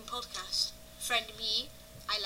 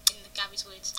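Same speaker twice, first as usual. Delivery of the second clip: normal talking voice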